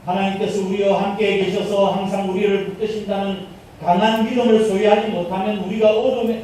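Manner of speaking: ordinary delivery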